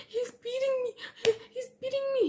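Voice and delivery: high, tearful plea; a teen's high fake-crying plea; mock wailing. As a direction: wail for help to the guards. Pleading to security guards.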